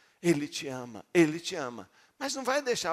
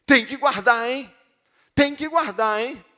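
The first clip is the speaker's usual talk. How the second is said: loud, high-pitched scolding order; threatening warning; 6 semitones higher, 8 dB louder than usual. Acted. Preacher spells out the legalistic reading, loud scolding. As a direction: lay down the law as a threat. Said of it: "Tipo o quê?".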